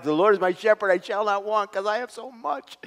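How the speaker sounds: smug mock reading. Shown as a demonstration. To the congregation, mocking a comfortable misreading. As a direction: read it like someone who has too much. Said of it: "That wasn't it".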